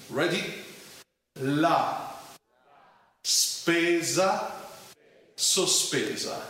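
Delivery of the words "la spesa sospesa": each word spoken separately, slowly. Shown as a demonstration. Teacher slowly spelling out a phrase for learners to repeat. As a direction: say every word slowly so they can repeat. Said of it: "slowly, I will say every word so you can repeat after me".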